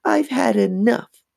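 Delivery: high, drawn-out exasperation; exasperated mock scream. A narrator's reading. Mom has had enough of the dummies, restrained scream. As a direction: act a scream with pitch, not volume. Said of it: "Missus Powell screamed".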